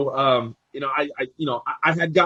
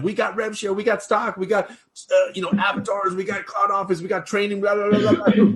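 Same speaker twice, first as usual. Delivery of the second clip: mock excited pitch; 5 semitones higher than usual. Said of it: "I was so excited".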